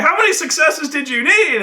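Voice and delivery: high, incredulous question; acted indignant demand. Game master as players challenging him, acted indignant demand. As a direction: demand an answer in mock outrage.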